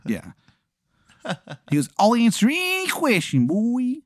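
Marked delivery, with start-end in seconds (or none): 2.0-4.0 high-pitched, hammed-up Maryland voice; bad Maryland accent; horrible Maryland accent. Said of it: "the accent is just".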